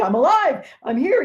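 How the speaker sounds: high, loud joyful cry; giddy with delight. Acted. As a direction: burst out alive and thrilled. Scrooge's joyful waking cry.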